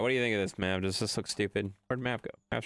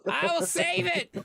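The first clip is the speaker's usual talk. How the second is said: mock heroic vow; 4 dB louder, slower than usual. Rushing to rescue the falling machine.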